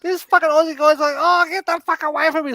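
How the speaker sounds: high, loud, shrieking mock outrage; mocking annoyed viewers. Mocking the people who complain about him, shrill and outraged. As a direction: shriek it like scandalised whiners. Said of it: "And they're like".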